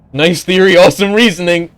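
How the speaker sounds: high, loud sarcastic praise; mock praise for a silly theory. Mocking praise.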